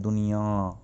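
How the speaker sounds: "दुनिया" with a nasalised, drawn-out last vowel; नाक से आवाज़. Shown as a demonstration. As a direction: say "दुनिया" ending through the nose, duniyaan. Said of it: "नाक से आवाज़ करते हैं". ("दुनिया" का अंत नाक से)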